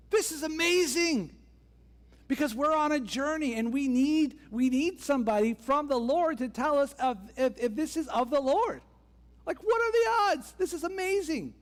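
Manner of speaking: high-pitched, acted-out thrill at a lucky find; excited amazement. Danites asking the Levite about their journey, excited. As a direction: gush high, then ease down into the request. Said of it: "the Danites are thinking".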